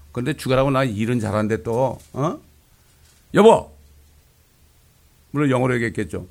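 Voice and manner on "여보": loud, high call to a husband. Retold or imitated. Wife calling her husband, loud and high. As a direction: call across the room to him.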